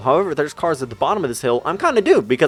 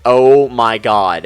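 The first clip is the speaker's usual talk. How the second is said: slow, louder drawn-out oh my God; panicked oh my God; 7 dB louder, much slower than usual. Retold or imitated. Sliding backwards down an icy hill, drawn-out panicked cry. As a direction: stretch it out as the car starts sliding. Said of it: "I'm like".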